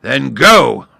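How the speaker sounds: loud roaring command; roared. A narrator's reading. Lion roaring the start of the fight. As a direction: launch the attack with a roar. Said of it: "roared the lion".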